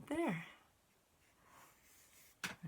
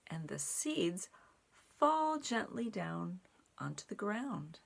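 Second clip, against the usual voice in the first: put-on reading to children; 4 dB louder than usual. A narrator's reading. Telling children how the wind stops, gentle storytime voice. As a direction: let the seeds settle gently. Reads picture book aloud.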